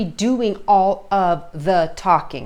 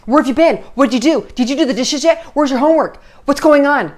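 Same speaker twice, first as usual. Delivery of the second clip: loud, higher, rapid-fire scolding questions; harsh parent tone; 5 semitones higher, 6 dB louder, faster than usual. Shown as a demonstration. Parent grilling teen about dishes and homework, loud harsh scolding. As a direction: nag the teen hard and loud. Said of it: "where it's just a constant harsh talk".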